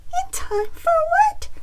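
very high, loud little chicken voice; worried chicken. A narrator's reading. Chicken wonders what Bear plans, high squeaky worry. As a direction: squeak it like a suspicious hen. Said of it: "Chicken thought".

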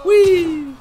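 high, drawn-out whee; gleeful whee. Real, not acted. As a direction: let out a joyride squeal. Swinging in game.

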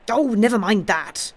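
high, loud, impatient outburst; feverish cry. A narrator's reading. Gibbs dismissing the priest's point about the hammer, feverish cry. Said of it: "cried Gibbs in a fever".

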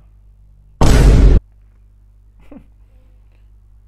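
big unvoiced laugh burst; loud laughing fit. Real, not acted. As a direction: crack up laughing hard. Laughing at own struggle.

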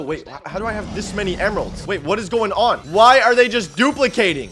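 ordinary delivery

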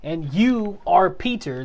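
slow, deliberate emphasis on you; pointed. Retold or imitated. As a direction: stress on you, drawn out slowly. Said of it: "pointing back at Peter saying".

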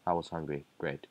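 ordinary delivery

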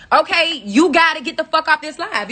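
furious host telling viewers to leave; angry dismissal. Real, not acted. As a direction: kick them off the stream. Kicking haters off her live.

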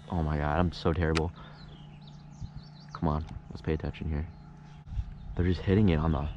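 ordinary talk

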